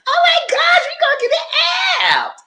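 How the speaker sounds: high, loud squeal of a quoted friend; mock excited shout. Friend mocked squealing about getting the app, high and loud. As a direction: shriek it, mocking her excitement.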